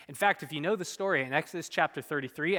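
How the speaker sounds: normal talking voice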